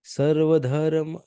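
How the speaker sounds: slow, high chanted Sanskrit opening; slow recitation. Shown as a demonstration. Teacher announcing the verse, slow high intonation. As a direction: intone the verse title slowly. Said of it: "ये हम लोग इकतीसवाँ श्लोक पढ़ते हैं अभी".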